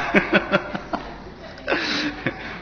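high, breathy falling laugh. Real, not acted. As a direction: laugh at the listeners' clinging to answers. Laughs at listeners' attachment. (高声大笑)

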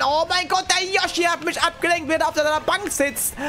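high-pitched agitated excuse; agitated protest. Real, not acted. Streamer blaming Yoshi for distracting him, agitated protest.

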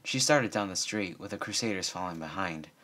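usual speaking voice

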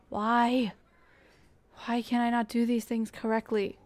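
loud, exasperated complaint. Real, not acted. Player berating self over botched building, exasperated. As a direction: burst out loudly in frustration at yourself.

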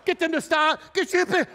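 frantic. Retold or imitated. Drum major panics.

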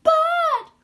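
high shrill alarm cry; panicked shout. Acted. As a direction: scream a warning at the others. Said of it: "cried the snails in panic".

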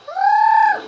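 high loud scream of alarm; panicked scream. Real, not acted. Player dying in the match, high scream. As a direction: scream as you get caught.

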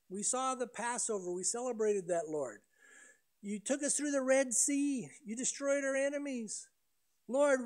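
a lightly voiced crowd in prayer; prayer voiced for the Israelites. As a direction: pray it out as a hopeful people. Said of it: "call out to the Lord".

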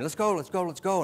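impatient urging. Retold or imitated. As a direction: mimic an impatient dog raring to leave. Voicing the impatient, bored dog.

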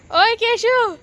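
loud high call of his name. Real, not acted. Aunt shouting Keshu's name across the yard. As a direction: shout to get his attention.

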